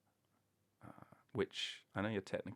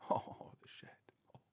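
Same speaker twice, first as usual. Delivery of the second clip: breathy mock-haunted groan; airy, voiceless moan of mock dismay; whispered lament; 6 dB quieter than usual. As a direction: whisper it like a tragic memory. Mock lament over the shed story.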